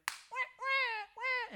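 high newborn wailing; baby crying wah. Retold or imitated. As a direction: wail like a newborn. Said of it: "since they went".

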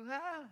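high newborn gasp; baby gasp. Retold or imitated. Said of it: "till they start to go".

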